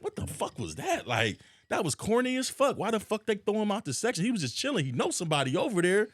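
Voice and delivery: worked-up complaint, voice riding high; retold loud talking. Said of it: "so I'm, I'm loud talking".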